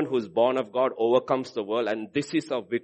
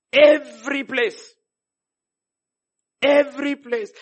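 Every place said slow, high and loud; stress on every place; 12 semitones higher, 7 dB louder, slower than usual. Shown as a demonstration. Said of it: "This is not walking".

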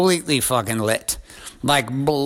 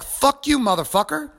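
much higher-pitched indignant comeback; retold angry retort; 13 semitones higher than usual. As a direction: fire the insult back, pitched right up. Acted retort in retold quarrel.

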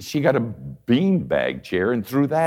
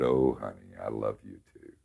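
tender father; 10 semitones lower, 8 dB quieter than usual. Retold or imitated. Father holding his sorry daughter in his lap, tender and hushed. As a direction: lower and much softer, tenderly.

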